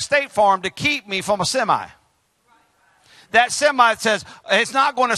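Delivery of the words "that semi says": ordinary delivery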